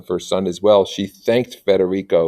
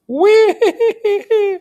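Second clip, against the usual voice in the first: high mock sob; 5 dB louder than usual. High squeal after weeping line.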